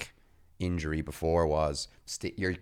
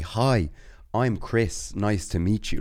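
speaking real clear; 4 semitones higher, 4 dB louder, slower than usual. Retold or imitated. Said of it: "Like, speaking real clear".

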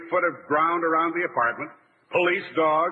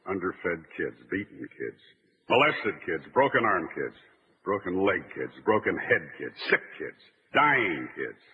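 lower, grave documentary narrator voice; solemn police monologue; 5 semitones lower than usual. Acted. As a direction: deliver it like a sincere tribute to cops. Sombre police recruitment speech.

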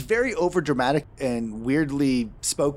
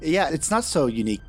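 ordinary delivery

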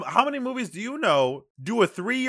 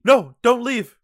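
higher and louder, hammed-up begging; mock desperate plea; 6 semitones higher, 7 dB louder, slower than usual. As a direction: cry out to a dying friend in jest. Plays along in deathbed scene.

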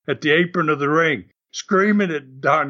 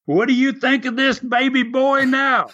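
high, laughing mocking taunt at the grandmothers; taunting heel; 8 semitones higher than usual. Heel wrestler taunting the grandmothers in the front row, mocking and laughing. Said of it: "the first thing he says to him".

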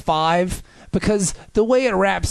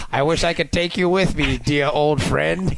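slow, lowered mock-sentimental farewell; earnest Picard; 3 semitones lower, slower than usual. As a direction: draw out a fond goodbye as Picard. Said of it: "Picard is seriously like".

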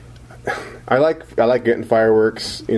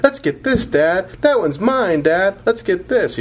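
put-on higher, eager begging kids; excited kid voice; 12 semitones higher, slower than usual. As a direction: mimic kids pleading with dad. Dad voices his kids.

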